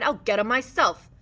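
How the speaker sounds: indignant cry. A narrator's reading. Slim jumping up to stop Curly, loud anger. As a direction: declare it loudly, ready to step in. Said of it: "he cried".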